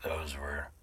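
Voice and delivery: ordinary talk, nothing marked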